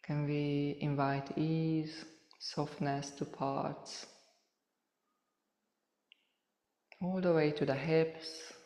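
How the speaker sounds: soft, unhurried meditation voice with long pauses; softly spoken, spacious yoga relaxation cueing; guided meditation. Real, not acted. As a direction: gently guide listeners through a body scan, leaving space between phrases.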